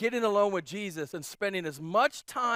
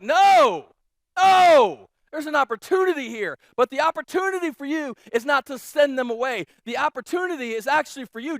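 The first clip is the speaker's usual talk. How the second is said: loud, higher, urgent, ramps then quickens; Jesus pressing the point; emphatic Jesus; 5 semitones higher, 8 dB louder than usual. Retold or imitated. Jesus telling the disciples to act, loud and urgent. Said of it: "Jesus is like".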